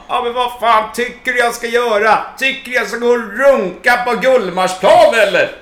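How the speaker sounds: loud, high, furious phone rant; angry shouting. Retold or imitated. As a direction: yell it at the phone, fed up. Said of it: "Och liksom bara".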